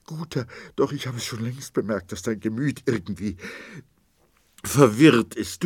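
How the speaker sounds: wife confesses her worry, sobbing; tearful. A narrator's reading. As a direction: apologise through tears. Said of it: "Sie schluchzte und bebte".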